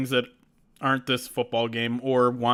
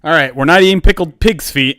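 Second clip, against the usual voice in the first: loud relief; 4 semitones higher, 13 dB louder than usual. Real, not acted. Relief over the spin result.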